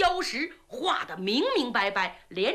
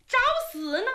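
angry threat; 10 semitones higher, faster than usual. Acted. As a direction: threaten him outright. Princess threatens the guard.

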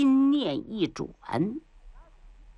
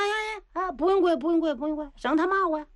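hasty wily minister; 8 semitones higher, much faster than usual. Acted. 秦桧 hastily waves off kneeling.

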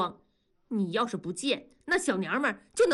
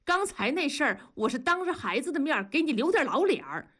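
higher-pitched angry interrogation; glaring accusation; 7 semitones higher than usual. A narrator's reading. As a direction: demand an answer, glaring. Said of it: "庄嫂就瞪着大庄说". (瞪眼质问)

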